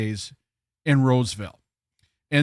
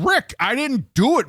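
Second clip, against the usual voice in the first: high-pitched, pleading denial; mock protest; 8 semitones higher than usual. Worker blames girlfriend to the manager, mock pleading protest. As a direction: protest your innocence to the boss.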